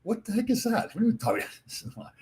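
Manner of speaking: mock disbelief, pitched high; high, incredulous what-the-heck; baffled teammates. Teammates baffled at the ref's ruling, high mock disbelief. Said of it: "were just looking like".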